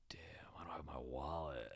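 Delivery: low, hushed retold realisation; barely voiced aside of dismay; dismayed whisper. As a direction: whisper it to yourself as it sinks in.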